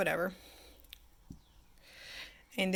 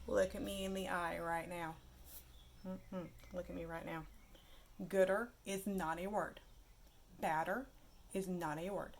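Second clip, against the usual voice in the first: much softer, deliberate admonishment; quiet, stern warning; 11 dB quieter than usual. Real, not acted. Teacher making the class heed a rule, quiet and stern. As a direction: drop the volume right down and insist.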